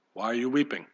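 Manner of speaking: hushed, tender ask; tender question. Acted. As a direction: ask it gently, like comforting a mourner. Jesus gently asking, softly quoted.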